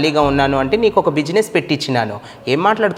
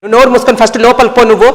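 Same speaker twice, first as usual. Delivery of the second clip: fast, high, loud barked command; angry order; 7 semitones higher, 8 dB louder, faster than usual. Acted. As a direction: snap at her to get inside. Brother orders sister inside.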